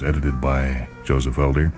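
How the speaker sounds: ordinary talk, nothing marked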